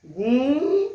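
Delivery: गूंजती with the ऊं ringing through both mouth and nose, loud; गूं said loudly with an open nasal vowel; nasal vowel demo. Shown as a demonstration. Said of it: "नाक से और मुंह से दोनों से आवाज आ रही है".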